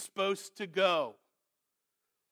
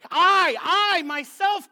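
"I, I, myself" high and very loud; more emphasis; 8 semitones higher, 11 dB louder, slower than usual. Shown as a demonstration. Preacher showing God's emphasis to the congregation, "I, I, myself" hammered. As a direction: punch "I, I, myself" hard, pitched right up. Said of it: "could he say it with more emphasis".

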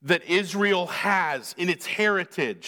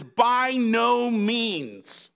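BY NO MEANS drawn out slowly and higher; emphatic refusal; 4 semitones higher, much slower than usual. Acted. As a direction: stretch "By no means" into a firm refusal. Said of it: "which is a very emphatic phrase".

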